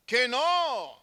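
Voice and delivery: high-pitched, drawn-out protest; mock-offended "no way"; indignant denial. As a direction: deny it emphatically, pitch soaring then falling. Acts indignant denial, extreme example.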